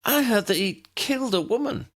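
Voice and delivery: put-on high, light girl's voice; piping girl voice. A narrator's reading. Girl pipes up to the professors, high piping voice. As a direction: pipe up like a young girl. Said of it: "Lydia piped up".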